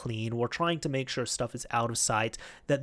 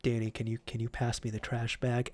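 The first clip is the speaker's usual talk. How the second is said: soft, deflated small request; scolded coworker quietly asking for a trash bag; 3 semitones lower, 8 dB quieter than usual. Retold or imitated. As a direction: ask meekly after being scolded. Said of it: "he just kinda quietly".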